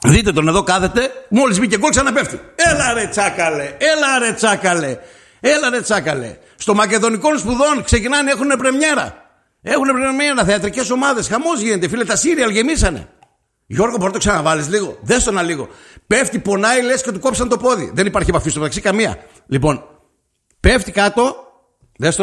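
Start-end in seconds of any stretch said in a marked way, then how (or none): 2.5-6.4 high, teasing jeer at a faker; sing-song mock cheer; mocking taunt. Mocking the player who dives. 6.6-13.1 pitched-up mocking commentary; mock showbiz announcement; sarcastic jibe. Sarcastic theatre jibe at diver. 16.1-17.9 high, scornful play-by-play; a hint of mock outrage; mocking exaggeration.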